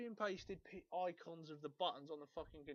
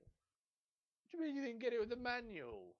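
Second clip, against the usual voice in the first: higher, louder mock-indignant reply; indignant seller; 7 semitones higher, 4 dB louder than usual. Seller protesting to the buyer, mock indignant and higher. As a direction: play the affronted seller, up and loud.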